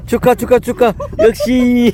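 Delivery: 훨씬 높은 톤으로 축하; excited congratulations. Real, not acted. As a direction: cheer him on, delighted. Congratulating the catch. (신나게 축하하는 말투)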